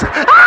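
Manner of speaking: sudden high yelp of panic; high scream. Retold or imitated. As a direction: cry out way up high in alarm. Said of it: "I was".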